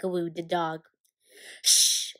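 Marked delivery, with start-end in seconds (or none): 1.6-2.1 sharp unvoiced hiss; hissed shush. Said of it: "hissed Professor McGonagall".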